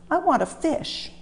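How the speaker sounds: high, pleading little engine; a squeaky engine whining for fish; whiny wish. Acted. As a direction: beg for a fish like a small child.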